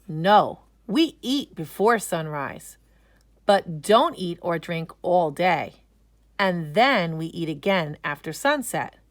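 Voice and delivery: usual speaking voice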